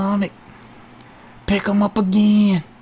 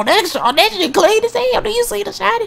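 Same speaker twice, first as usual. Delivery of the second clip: much higher excited squeal; squealing excitement; 13 semitones higher than usual. Real, not acted. Commentator marveling at a clean slam, high squeal. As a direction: squeal with delight at the move.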